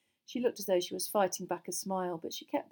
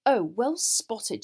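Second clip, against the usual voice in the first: slow, loud, biting sarcasm; acidly; 4 semitones higher, 9 dB louder, much slower than usual. A narrator's reading. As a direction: draw it out with acid scorn. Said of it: "she said acidly".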